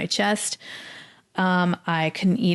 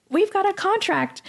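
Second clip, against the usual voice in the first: high, lightly mocking service-man voice; 13 semitones higher than usual. Imitating the Cintas man explaining the contract, high and lightly mocking. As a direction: toss it off like a bored worker.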